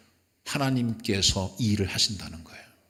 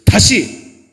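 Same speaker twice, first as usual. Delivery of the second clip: loud threatening bark from an enemy; loud, drawn-out threat; 5 semitones higher, 10 dB louder, much slower than usual. Acted. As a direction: menace them, loud and slow. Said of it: "여러분, 위협하는 거예요".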